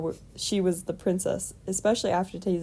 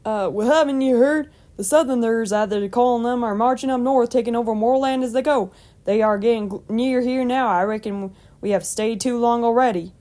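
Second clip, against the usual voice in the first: louder, higher folksy farmer; Lone; 4 semitones higher, 6 dB louder than usual. A narrator's reading. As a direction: exclaim the news as an old country man. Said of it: "exclaimed Lone".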